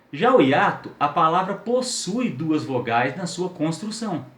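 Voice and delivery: normal talking voice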